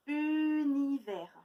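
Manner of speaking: univers slowly, lingering on the u; drawn-out word. Shown as a demonstration. Teacher to pupils, slowly stretching the word. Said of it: "d'autres mots où on entend U".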